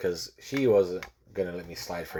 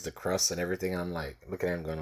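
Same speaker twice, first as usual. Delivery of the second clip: ordinary talk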